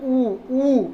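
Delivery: ऊ said twice with rounded lips; lip vowel demo. Shown as a demonstration. Teacher demonstrating ऊ with rounded lips for students. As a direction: sound out ऊ, ऊ, lips pushed forward. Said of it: "हम बोल के भी देख लें".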